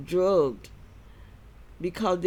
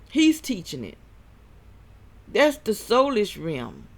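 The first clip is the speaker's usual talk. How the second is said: preaching; 4 semitones higher, 3 dB louder than usual. Acted. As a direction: warn them like a preacher. Preaching against soulish realm.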